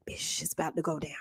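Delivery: hushed whisper of gossip. Retold or imitated. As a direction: whisper it like a secret. Reading secret message.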